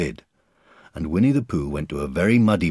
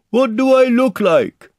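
high, drawn-out shout; calling down; 11 dB louder, slower than usual. A narrator's reading. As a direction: call it to someone far below. Pooh shouting down from balloon.

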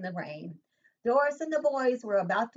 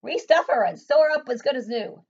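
louder firm command; a mother laying down the law; stern order; 4 semitones higher, 8 dB louder than usual. Acted.

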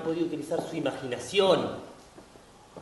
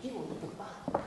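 much softer, almost to himself; breathed, far-away admiration; hushed aside; 3 semitones higher, 13 dB quieter than usual. Acted. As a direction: murmur it in private wonder. Admiring the courtyard twice.

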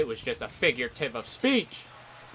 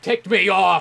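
mock angry; 6 semitones higher, 9 dB louder than usual. Angry at enemy.

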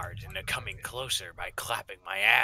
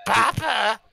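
put-on high, loud little-kid cry; child calling; 13 semitones higher, 8 dB louder than usual. Small child calling out for papa. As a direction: call out for your dad like a small child.

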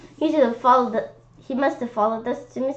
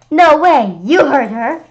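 put-on louder, higher kid yell; 6 semitones higher, 9 dB louder than usual. A narrator's reading. Kid yelling at the monster to protect her. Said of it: "I yelled".

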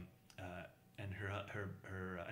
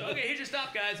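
imitating the driver; 11 semitones higher, 5 dB louder than usual. Driver voiced laughing.

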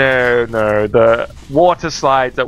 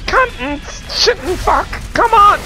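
frustrated shouting. Real, not acted. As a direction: rage at the game. Kill missed, timer running out.

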